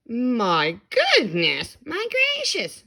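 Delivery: loud, slow then high; amazed shout. A narrator's reading. Crowd marveling at the baby, loud and amazed. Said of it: "they shouted".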